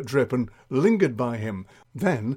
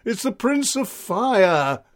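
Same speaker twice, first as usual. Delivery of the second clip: slow, raised, ominous announcement; portentous alarm, pitched up; dramatic reveal; 8 semitones higher, 3 dB louder, slower than usual. Acted. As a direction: stretch out the dreaded name for effect. Sergeant reveals dreaded foe.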